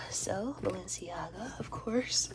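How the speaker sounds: slow, hushed whisper of the brand name; awed whisper. Real, not acted. Unboxing her Balenciaga boots, a reverent whisper. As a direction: whisper the label like a secret treasure. Revealing the designer label.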